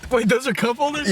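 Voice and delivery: startled question about a discovery; surprised. Real, not acted. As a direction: pitch jumps up in disbelief. Bloopers, finding hidden cup holders.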